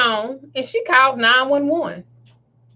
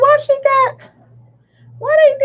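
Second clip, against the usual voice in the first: high, louder mock outrage; judgmental disbelief; 13 semitones higher, 4 dB louder than usual. Shopper retelling her reaction to the leash, incredulous. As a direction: push the startled question up high.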